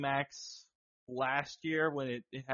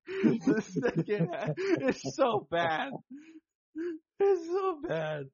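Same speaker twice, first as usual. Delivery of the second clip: slow, higher, laughing it out; 11 semitones higher, 3 dB louder, slower than usual. Real, not acted. Cracking up at his own chat message. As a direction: laugh through how bad it is.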